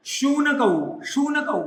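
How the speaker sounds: शूनकौ opened with a "sh" sound; palatal sha. Shown as a demonstration. Teacher modelling Sanskrit for children, palatal sha in शूनकौ. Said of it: "ताल पे श का उच्चारण कीजिएगा".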